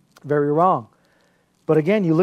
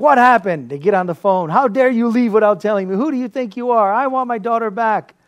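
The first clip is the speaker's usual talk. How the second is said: angry father on the phone; 5 semitones higher, 4 dB louder than usual. Retold or imitated.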